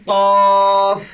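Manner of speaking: loud, long held "কফ"; throaty thick কফ. Shown as a demonstration. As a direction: model the heavy throat q on "কফ". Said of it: "এটা গলা থেকে আসবে".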